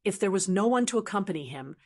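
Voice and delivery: usual speaking voice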